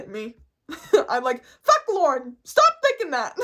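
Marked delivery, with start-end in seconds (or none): 1.6-3.4 high, loud cartoon squeak; loud squeaky mouse voice; Mickey Mouse. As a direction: scold yourself in a squeaky cartoon voice. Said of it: "kinda sound like Mickey Mouse".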